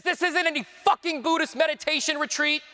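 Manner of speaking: loud, high-pitched furious yell, acted out; angry outburst. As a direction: explode at someone in mocking rage, retold. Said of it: "He gets so angry".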